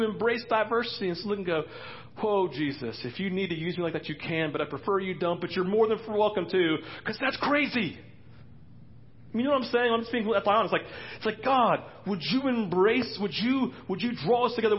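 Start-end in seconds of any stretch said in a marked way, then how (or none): 2.2-8.0 high, loud, hammed-up nervous believer; a wary churchgoer bargaining with Jesus, much higher and louder; reluctant mock prayer. Cerebral believer nervously telling Jesus, hammed-up and much higher. 11.4-14.8 acted pleading prayer. Pastor acts out a pleading prayer.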